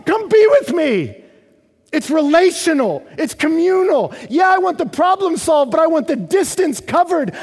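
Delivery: high, shouted preaching with pleading force; impassioned preaching. Acted. Preacher voicing the psalmist's plea to God, impassioned shout. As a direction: cry out demands and pleas at full voice.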